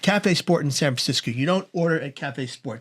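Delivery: ordinary talk, nothing marked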